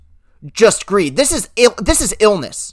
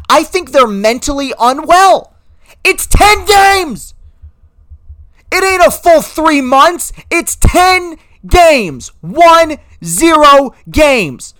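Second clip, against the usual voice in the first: high, loud-ish hammering of ten games; furious disbelief, slow and high; exasperated rant; 7 semitones higher, 3 dB louder, slower than usual. Real, not acted. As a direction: spell it out slowly in disbelief. Ranting at owners over ten games.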